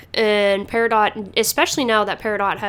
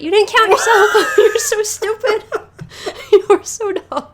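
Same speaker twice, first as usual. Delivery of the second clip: much higher and louder, cackling between words; laughing mockery; 12 semitones higher, 5 dB louder than usual. Real, not acted. Host mocking the ruby who miscounted, high laughing voice. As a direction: crack up at the ruby who forgot herself. Mocking the ruby's counting mistake.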